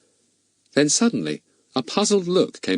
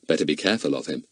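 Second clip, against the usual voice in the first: plain reading voice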